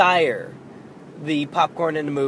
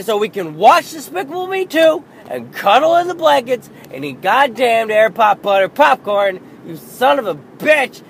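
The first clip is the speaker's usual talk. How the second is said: much higher, louder frustrated shouting; exasperated yell; 9 semitones higher, 8 dB louder than usual. Retold or imitated. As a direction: vent like a fed-up parent. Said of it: "in my head, I'm just like".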